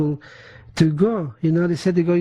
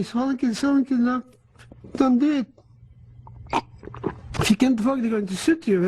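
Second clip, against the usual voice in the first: the father's urgent plea, voiced higher; pleading father; 6 semitones higher than usual. Father urging his collapsing son to keep walking, higher and urgent. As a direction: beg your son not to give up, higher voice.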